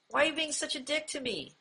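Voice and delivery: sweet, tender. Shown as a demonstration. Teacher showing passive-aggressive phrasing in a sweet, tender tone. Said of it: "in, like, a very sweet or a, almost like a tender tone".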